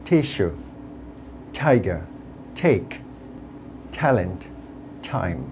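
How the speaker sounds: each T puffed, from "talk" to "time"; word-list drill with airy T, as in "table"; aspirated T words. Shown as a demonstration. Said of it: "শব্দগুলোকে আমাদের এইভাবে উচ্চারণ করতে হবে". ("table", "time"-এর ট হালকা ঠ-এর মতো)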